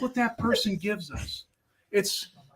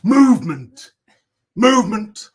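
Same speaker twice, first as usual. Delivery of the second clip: mock dramatic proclamation; 3 semitones higher, 9 dB louder than usual. Hammed-up art critique.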